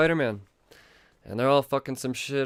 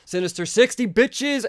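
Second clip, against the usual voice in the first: higher, louder triumphant cry; gleeful shout; 11 semitones higher, 6 dB louder than usual. Retold or imitated. Fan shouting at the comic in gleeful triumph. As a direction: crow it out in glee. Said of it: "I was like".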